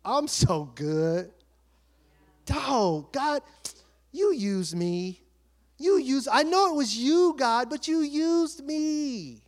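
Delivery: slow, stretched-out mock gloating; hammed-up humble-brag to God; mock self-satisfaction. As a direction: savor it, drawing the words out in pretend pride. Imagined self-congratulating boast to God.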